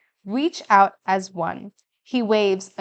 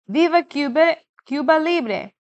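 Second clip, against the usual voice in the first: cheering; 5 semitones higher than usual. A narrator's reading. Family yelling at parade.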